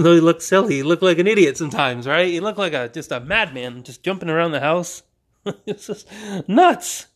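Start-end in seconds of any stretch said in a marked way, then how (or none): 5.4-7.1 laughing. Real, not acted. Laughing at client's silly jumping.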